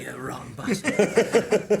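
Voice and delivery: a caught-on laugh at the prank; laughing Paul. Retold or imitated. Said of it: "he's like".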